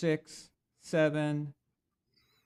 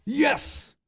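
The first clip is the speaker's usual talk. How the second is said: excited cheer; 11 semitones higher, 5 dB louder than usual. Real, not acted. Cheers at winning the trivia point.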